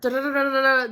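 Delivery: engine cranking imitation. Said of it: "And then it goes".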